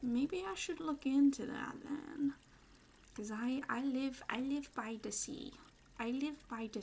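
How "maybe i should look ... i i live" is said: ordinary talk, nothing marked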